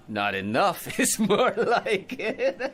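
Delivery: slow words broken by a chuckle; laughing. Acted. Drunk McNulty jokes to the officer through laughter. Tipsy man joking with a cop.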